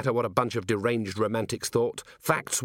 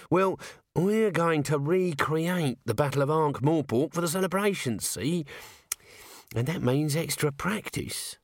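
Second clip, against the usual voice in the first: higher, hesitant, nervous little corporal; raised wheedling voice, full of ums; Nobby; 6 semitones higher than usual. A narrator's reading. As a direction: stammer it out like a nervous underling. Nobby explains the battle.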